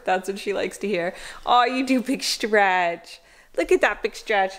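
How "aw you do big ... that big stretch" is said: pet talk. Real, not acted. Talking to stretching dog.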